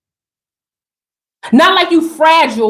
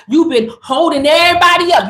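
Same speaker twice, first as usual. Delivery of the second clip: ordinary delivery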